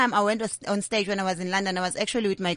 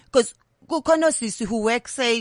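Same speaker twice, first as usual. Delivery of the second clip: ordinary talk, nothing marked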